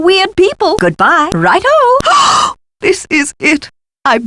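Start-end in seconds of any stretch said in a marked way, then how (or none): 1.7-2.5 high, sharp gasp; theatrical intake of surprise; astonished gasp. Acted. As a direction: gasp in sudden amazement. Astonished at the find.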